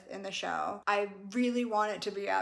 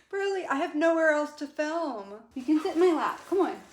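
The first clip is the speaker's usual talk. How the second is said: high, louder coaxing pet voice; talking to the dog; 6 semitones higher, 5 dB louder than usual. Real, not acted. Coaxing Pearl the husky onto her lap, in a high, louder pet voice.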